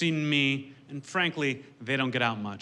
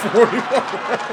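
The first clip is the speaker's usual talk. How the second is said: "Forty-five" shouted high and loud through a laugh; 8 semitones higher, 10 dB louder, slower than usual. Retold or imitated. Mocking the small population.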